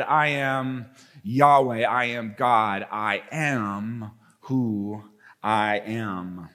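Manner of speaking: slow, deep and hushed, each word weighed; voice of God. Acted. Preacher introducing the showdown, voicing God slow and deep. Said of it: "And on the other side".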